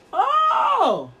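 long, high, drawn-out "oh"; mock astonishment. Preacher reacting to a proud boast, mock astonished.

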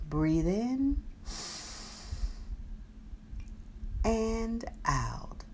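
soft, slow breathing cue; guided meditation. Acted. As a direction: breathe the words out gently.

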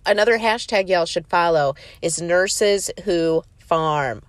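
normal talking voice

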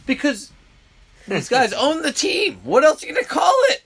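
high, loud, fast exasperation; exasperated rant. Real, not acted. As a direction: rant it, voice climbing. Heated rant over owner term.

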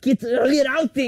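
high, loud, slow order; mom impression. Mom bossing dad over the map, high and drawn-out. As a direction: order it in a put-on mother's voice. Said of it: "And she's like".

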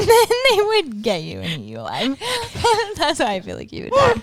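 giggling through the words; words broken by laughter; laughing while talking. Real, not acted.

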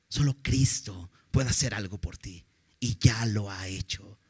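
soft whispered certainty. Real, not acted. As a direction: drop to a whisper for the key truth. Predigthöhepunkt, leise Gewissheit.

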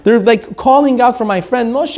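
ordinary delivery

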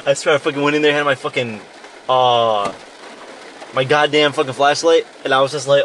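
ordinary delivery

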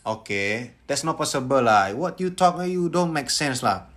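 drawn-out Singlish objection; Singaporean English. Retold or imitated. Son imitating dad objecting, Singaporean English. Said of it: "Inggrisnya Inggris Singapura".

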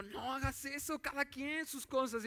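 tono agudo de papá tacaño que protesta; papá codo. Retold or imitated. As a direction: reclama con voz más alta de tono, sin gritar. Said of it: "Y yo como papá, pues que codo". (papá tacaño, en tono de queja)

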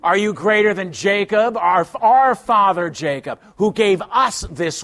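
mocking challenge to the stranger; obnoxious attitude. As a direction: throw it back at him with attitude. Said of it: "She's a woman with an attitude".

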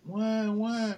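high mock baby crying, wah wah; mock toddler wail. Dad mimics his daughter crying at bedtime, high mock wail. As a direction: whine it like a fussy toddler.

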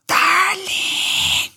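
high, ecstatic shout of a pet name; joyful shout. Retold or imitated. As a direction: yell it while running with joy. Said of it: "corriendo a toda velocidad". (grito de alegría muy agudo, corriendo por la calle)

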